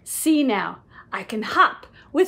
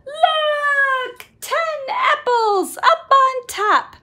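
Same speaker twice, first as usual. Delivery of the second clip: triumphant shout; 9 semitones higher, slower than usual. Acted. Story animal proudly shouting to the others. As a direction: crow it out in triumph.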